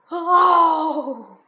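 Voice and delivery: loud, high dinosaur howl; anguished roar. Acted. Father dinosaur breaks off with a loud anguished roar. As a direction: let out a pained roar.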